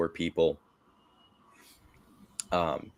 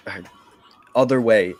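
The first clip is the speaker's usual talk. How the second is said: urging, a touch louder; urgent dying brother; 3 semitones higher, 3 dB louder, much faster than usual. Acted. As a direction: push him to go the other way. Brother urges Yori to flee.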